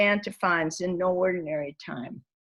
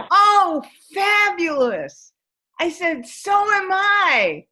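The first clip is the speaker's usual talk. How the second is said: delighted; 10 semitones higher, 6 dB louder, slower than usual. Retold or imitated. I was so happy.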